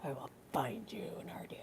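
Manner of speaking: very soft, whispered mock threat; menacing whisper. Comic vowing to hunt someone down, menacing whisper. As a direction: whisper it like a stalker's vow.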